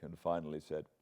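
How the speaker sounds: ordinary delivery, nothing marked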